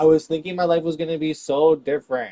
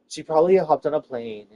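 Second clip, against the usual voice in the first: ordinary talk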